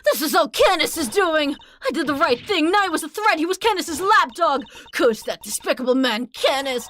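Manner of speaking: a lightly voiced cornered culprit, bitter; cornered villain. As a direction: grumble the blame onto Canis.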